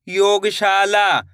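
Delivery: "योगशाला" stretched very slowly, every syllable drawn out; slow blended word. Shown as a demonstration. Teacher blending the word again for pupils, drawn out very slowly. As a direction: pull the word apart as you blend it. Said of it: "कैसे पढ़ेंगे?".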